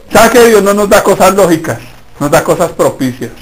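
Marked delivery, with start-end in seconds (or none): none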